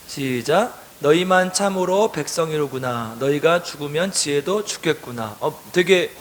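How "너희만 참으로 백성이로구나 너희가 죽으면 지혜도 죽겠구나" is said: slow, lower read-aloud verse; measured congregational Bible reading; scripture reading. A narrator's reading. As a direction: read it out evenly for a group to follow.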